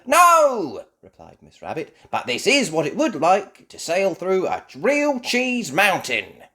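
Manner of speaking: high, loud explaining; Miss Rabbit. Acted. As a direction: explain brightly.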